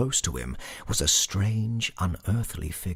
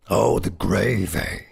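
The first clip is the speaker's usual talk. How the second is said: loud, drawn-out goblin voice; knowing goblin; 2 semitones higher, 7 dB louder than usual. A narrator's reading. Goblin takes up the sexton's answer, loud and knowing. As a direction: draw it out loudly as the goblin.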